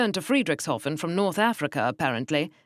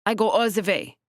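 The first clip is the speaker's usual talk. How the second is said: put-on German accent; 5 semitones higher, 4 dB louder than usual. German woman's reply.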